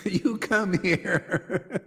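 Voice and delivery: slow words dissolving into laughter; laughing. Real, not acted. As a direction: stretch it out and crack up. Breaks into laughter repeating quote.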